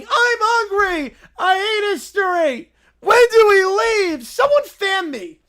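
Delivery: put-on loud, slow, high whining children; whiny kids. Kids whining at a dragged-out trip, high and loud. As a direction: whine like bored kids dragged along. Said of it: "The kids continue whining".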